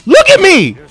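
high, loud excited calls; excited showing off. Real, not acted. Player showing off a move, excited calling out.

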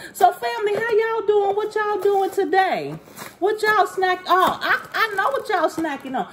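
put-on high-pitched playful kid voice; seven-year-old self. Greeting the family about snacks, pretend seven-year-old voice. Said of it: "transitioning to my seven-year-old self".

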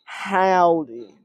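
lightly drawled Texas greeting; a playful cowboy howdy. Pretend. Gamer greeting viewers with a light Texas howdy. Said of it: "Texas voice here".

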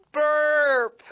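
big belching burp; loud burp. A narrator's reading. Snake burps.

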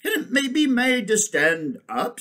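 high, loud elephant wondering aloud; thoughtful elephant. Acted. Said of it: "said the elephant thoughtfully".

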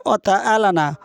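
ordinary talk, nothing marked

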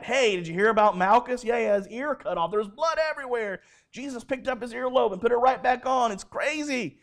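high, quick chatter of townsfolk; excited gossip. Retold or imitated. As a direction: spread amazing news like a gossip. Townspeople gossip about Malchus.

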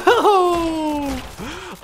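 high breathy laugh; laughing at failure. Real, not acted. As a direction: laugh off the disaster. Laugh after failed charge.

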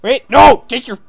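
high, loud excited yelling; excited shout. Real, not acted. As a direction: yell it out in delight. Excited at resurrected character.

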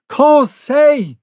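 high loud call of his name; crying out. A narrator's reading. Professor calls out to stop his servant firing, loud cry. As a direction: shout the name to stop him. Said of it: "cried I".

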